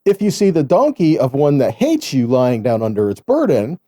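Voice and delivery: ordinary talk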